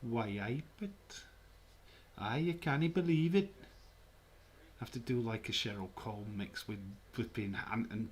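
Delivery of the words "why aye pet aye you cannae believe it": higher-pitched mock Geordie; hammed-up Newcastle accent. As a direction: put on a Geordie lilt. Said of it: "You want a Newcastle accent?".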